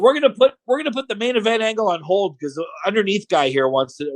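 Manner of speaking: high-pitched mock announcement; sarcastic. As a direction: make a big show of fake importance. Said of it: "he's super sarcastic".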